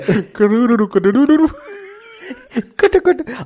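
high cooing coo-coo noises; cooing pigeon voice. Acted. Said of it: "my pigeon voice".

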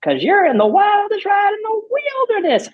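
high, loud, full-throat yell; excited rider screaming along; screaming the line. Retold or imitated. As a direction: belt it like you're on the coaster. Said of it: "I scream that line at the top of my".

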